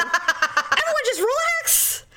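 high, stretched-out call, laughing; mock exasperated order. Retelling calming her frantic family, high drawn-out order. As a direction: draw out the command up high.

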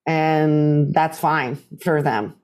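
ordinary talk, nothing marked

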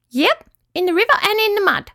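high squeaky little creature; tiny high-pitched squeak; Petruchio. A narrator's reading. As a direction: squeak it as a small yabby. Yabby answers Shaggles.

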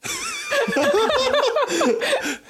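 high mocking villain laugh; evil chuckle. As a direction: laugh at him like a gloating rogue. Thief laughs at the intruder.

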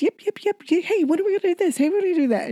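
very high, yapping little dog voice; yappy little cartoon dog. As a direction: yap like a tiny excited dog. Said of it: "I'm the little dog".

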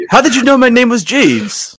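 high puzzled butler; surprised butler voice. Acted. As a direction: ask it as a baffled butler. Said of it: "It's like".

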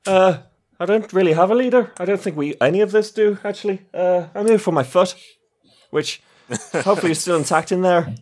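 high, fast, calling out cheerfully; breezy shout across the room; Triss calling out. Acted. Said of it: "And Triss, uh, is gonna call out".

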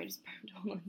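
ordinary talk

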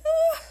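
a very high, loud mock yelp; high-pitched startled ah. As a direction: squeak in alarm. Steed startled by the question.

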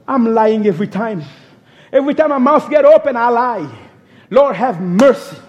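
usual speaking voice

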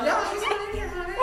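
very high, loud joke holler through laughter; mock calling out. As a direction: pretend to sing out to someone outside, laughing.

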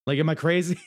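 usual speaking voice

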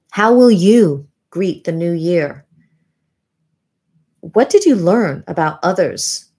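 usual speaking voice

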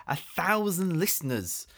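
high frantic dude; panicked scolding. Acted. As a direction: hush him in a panic. Panicked host scolding guest.

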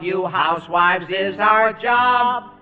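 slow rhyming show intro; slow sing-song intro. Acted. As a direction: recite the rhyme slowly like TV hosts. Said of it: "the T V twins, Fred and Bob".

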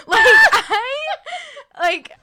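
laughing outburst. Real, not acted. Laughing outburst about blocking people.

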